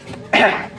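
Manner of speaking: clearing phlegm from throat; quick rough throat clear. Real, not acted. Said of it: "Got some phlegm".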